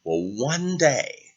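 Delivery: plain reading voice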